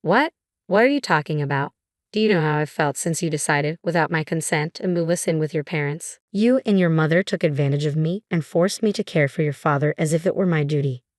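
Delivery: plain reading voice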